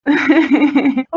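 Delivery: short happy laugh; pleased laugh. Real, not acted. Wife laughing at her husband's boast, pleased and light. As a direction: laugh with a flattered glow.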